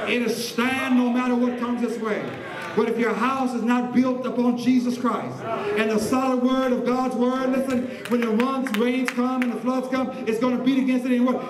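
sustained, insistent preacher's delivery; impassioned preaching. Real, not acted. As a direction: declare it to the congregation. Preaches to responding congregation.

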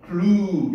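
clue의 모음을 길게 늘여서; clue, '클루-' 하고 끌기. Shown as a demonstration. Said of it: "이렇게 길게 해주라". (clue를 길게 뽑아서)

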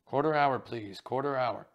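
loud call, slowing on the repeat; stage call. Acted. Call boy announcing the quarter hour outside, loud call. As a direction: call it out down the corridor.

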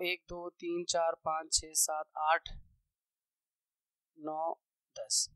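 very soft, low counting under the breath; counting to self. Real, not acted. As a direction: count quietly to yourself while writing. Counting items while writing data. (धीमी आवाज़ में खुद से गिनती)

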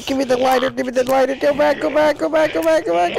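high, loud repeated shouts to go back; panicked yelling. Real, not acted. Player yelling at a game monster, panicked shouting. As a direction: yell it again and again as it closes in.

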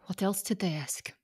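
hushed, secretive whisper. Acted. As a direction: ask it under your breath so no one hears. Secret talk about suspicious satchel.

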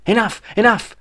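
high, excited burst; excited exclaim. Acted. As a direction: blurt it out like a giddy kid. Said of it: "exclaimed Jeff".

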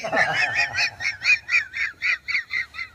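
very high breathless wheeze; hysterical laugh. Real, not acted. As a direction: laugh with no air left. Helpless laughter.

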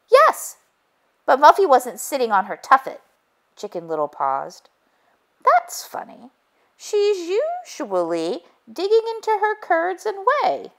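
high, anxious hen voice rushing through it; Chicken Little, flustered. A narrator's reading. Hen telling the detective what she saw, high and flustered.

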